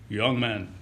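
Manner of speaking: a hint of a deep bass voice, lightly done; understated bass greeting from the archbishop; deep baritone voice. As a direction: lightly imitate the archbishop's low voice. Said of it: "deep baritone voice".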